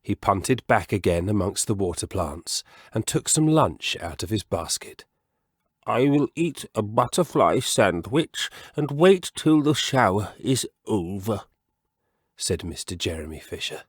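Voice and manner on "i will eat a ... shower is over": lightly voiced contented frog; a hint of a pleased old frog; mild frog voice; frog character voice. Mr Jeremy Fisher's voice.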